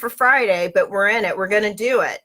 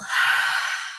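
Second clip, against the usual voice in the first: soft breathy sigh out; guiding an exhale with a sigh; exhaled sigh; 3 dB quieter than usual. Shown as a demonstration. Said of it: "and exhale".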